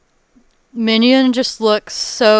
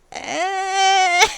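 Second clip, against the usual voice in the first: grunt; 9 semitones higher than usual. Pretend. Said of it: "Minion's like".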